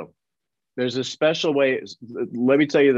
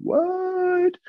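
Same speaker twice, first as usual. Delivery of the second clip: squeaky high what; stunned high-pitched what; shocked what. Retold or imitated. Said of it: "I'm like".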